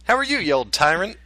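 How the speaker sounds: ordinary delivery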